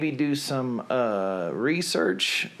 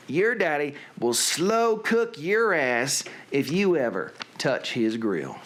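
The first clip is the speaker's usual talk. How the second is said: slow mock Southern warning; slower than usual. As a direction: stretch it out in a put-on Southern accent. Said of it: "to do in a Southern accent".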